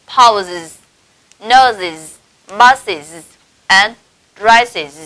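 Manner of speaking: "pauses, noses, buses and dresses", each ending in -iz; word-list demo of "pauses, noses, buses and dresses", two falling syllables each; IS plural endings. Shown as a demonstration. Teacher modelling for students, "pauses, noses, buses and dresses" with clear -iz. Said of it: "chúng ta sẽ đọc thành".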